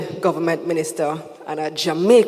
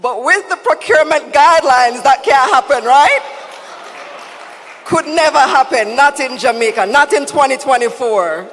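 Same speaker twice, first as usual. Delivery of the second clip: loud, higher, laughing irony; ironic mock assurance; 5 semitones higher, 6 dB louder than usual. Real, not acted. Emcee joking about procurement, mock-serious and laughing. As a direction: tease the audience with fake certainty.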